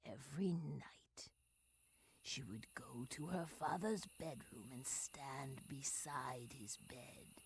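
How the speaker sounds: whispered storytelling, soft and secret; low bedside whisper; hushed whisper. Acted. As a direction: breathe the words like a secret. Hushed story of nightly visits.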